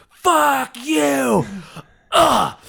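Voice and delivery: high, drawn-out mock whining rant; mock exasperated screech. Imitating a woman fuming over a parking spot, mock screech. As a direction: imitate a stressed-out driver screeching. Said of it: "and she's like".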